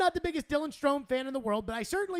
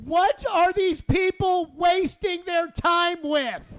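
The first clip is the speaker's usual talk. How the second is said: slow, loud, higher exasperated rant; exasperated shout; 5 semitones higher, 7 dB louder, much slower than usual. Real, not acted. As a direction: stretch it out in disbelief, loud. Host ranting at team signing.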